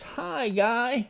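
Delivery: reply with a lifted storybook greeting; panda's greeting. A narrator's reading.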